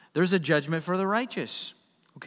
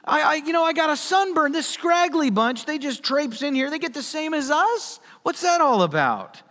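whiny grumbling worker; 11 semitones higher, 6 dB louder than usual. Acted. Workers complaining to the master about equal pay, high whining. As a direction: gripe like a sunburned laborer. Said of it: "these guys are like".

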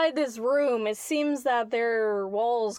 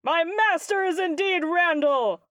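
proud declaration; 7 semitones higher, 3 dB louder than usual. Acted.

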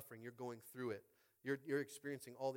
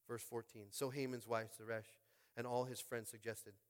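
ordinary delivery

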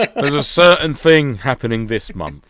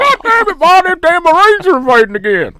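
much higher and louder, a hammed-up yokel hollering; mock hillbilly yell; 7 dB louder than usual. Co-host jokingly yells to Ma about invading Marines, hammed-up hillbilly holler. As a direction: holler for Ma like a panicked country bumpkin.

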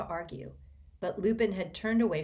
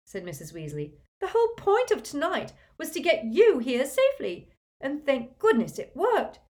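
higher, louder fussing mother; motherly Mrs Weasley; 8 semitones higher, 7 dB louder than usual. A narrator's reading. Mrs Weasley scolding Harry for wanting to leave: high fussing voice. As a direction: scold him like a worried mum.

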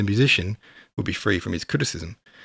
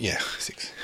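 whispering; 2 semitones lower, 9 dB quieter than usual. Real, not acted. Muttering to himself, checking chapter.

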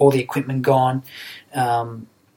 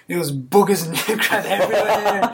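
laughing through the words, higher and slow; 6 semitones higher than usual. Real, not acted. Telling the podcast hosts about crying, laughing. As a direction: laugh at the messy memory as you say it.

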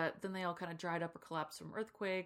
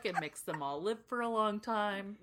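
ordinary delivery

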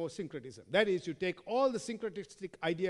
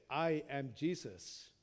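voice of Jesus; 7 semitones lower, 6 dB quieter than usual. Acted. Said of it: "And he said".

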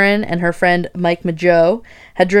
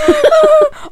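high laugh; 4 dB louder than usual. Real, not acted. Laughing at joke.

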